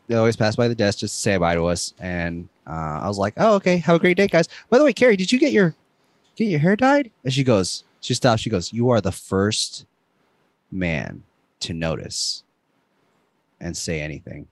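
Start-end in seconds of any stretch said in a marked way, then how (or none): 3.4-7.1 high-pitched, breezy small talk; casual friendly chirp. Retold or imitated. Re-enacting a goodbye to the gym crush, chirpy and casual. 8.7-14.5 low and slow with long pauses; slow, pointed reply. Retold or imitated. Voicing the gym crush's answer, slow and pointed.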